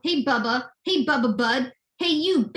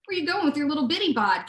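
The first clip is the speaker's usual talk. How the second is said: normal talking voice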